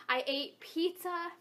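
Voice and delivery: usual speaking voice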